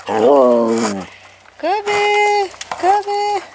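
high drawn-out name call; calling a pet. Real, not acted. Owner calling Kobe over, high drawn-out call.

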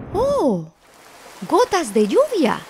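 high mouse exclamation; ratón muy alto. Acted. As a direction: exclaim in a high storybook character voice. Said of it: "ratón muy alto dijo". (exclamación aguda de ratón de cuento)

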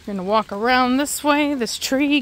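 ordinary talk

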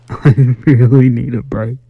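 laughing through the words; exasperated laughing. Real, not acted. Player needing a break from this game, exasperated laugh. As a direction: laugh it off, worn out.

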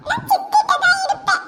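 put-on small boy clinging to his aunt; toddler voice. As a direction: speak like a tiny stubborn child. Toddler refuses grandmother.

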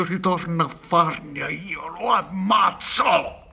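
swearing he'll kill them; furious outburst. Acted. Son rages at absent brothers.

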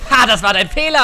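loud triumphant taunt; gloating shout. Real, not acted. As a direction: yell it in triumph. Taunting opponent.

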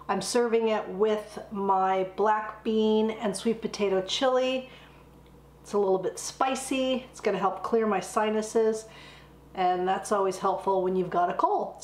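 ordinary delivery

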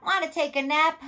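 high, loud, animated invitation; storybook cat. A narrator's reading. Cat inviting the spider to nap, high and loud. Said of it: "cried the cat".

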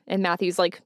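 usual speaking voice

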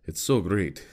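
mock the bartender's Italian accent, gushing; lightly mimicked Italian accent of the bartender praising American accents. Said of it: "very, very, very thick Italian accent".

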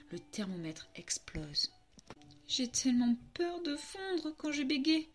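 ordinary delivery, nothing marked

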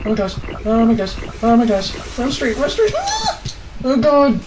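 high loud panicked shrieks with a nervous laugh; terrified shrieking. Real, not acted. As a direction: lose it completely in fear. Still fleeing, terrified.

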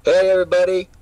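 hollow loud greeting through a cup. Real, not acted. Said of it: "I was talking into a cup".

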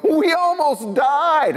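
high, slow, thrilled cry; excited. Retold or imitated. Teen to best friend after a near-death stunt, thrilled high cry. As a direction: gasp it out like you barely survived. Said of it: "you looked at your friend all excited".

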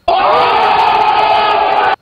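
slow, loud booming reveal; dramatic shout. Acted. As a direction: boom it out like a trailer. Hyped breaking news reveal.

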